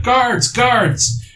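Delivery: high, loud shout for the guards; acted alarmed cry; calling for help.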